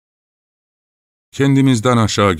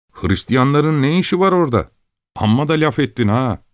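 put-on higher, indignant young voice; scoffing village lad; 9 semitones higher than usual. A narrator's reading. Lad scoffing at Chelkash, higher indignant voice. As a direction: brush off a silly idea.

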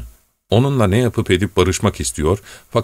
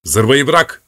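sharp cut-off; 5 semitones higher, 4 dB louder than usual. Acted. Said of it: "diye sertçe sözünü kestim".

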